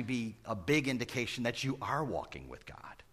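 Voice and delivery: hushed, breathy confiding; preacher whispering reassurance to the congregation. Real, not acted. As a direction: whisper it as a secret comfort. Intimate reassurance in sermon.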